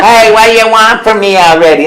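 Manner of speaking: high, loud, gruff Brooklyn plumber with a laugh in it; a pushy Brooklyn tough guy, laughing. Acted. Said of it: "Italian plumber from Brooklyn".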